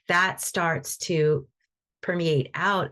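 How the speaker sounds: usual speaking voice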